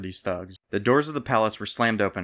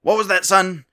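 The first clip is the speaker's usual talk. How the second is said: much higher, loud royal demand; 10 semitones higher, 8 dB louder than usual. A narrator's reading. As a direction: pounce on the guard's words. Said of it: "demanded the king".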